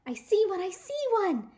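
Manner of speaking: very high, loud thrilled shout; excited shouting. Acted. Sheldon spotting another egg, excited shout. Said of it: "he shouted, even louder than before".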